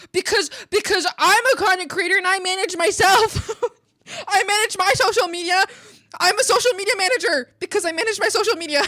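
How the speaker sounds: loud, rising insistence; defensive rant. Real, not acted. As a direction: justify yourself loudly. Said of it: "defensively justifying resume".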